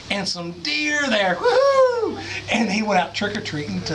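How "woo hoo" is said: high, loud whoop; excited cheer. Acted. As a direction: whoop it out with joy.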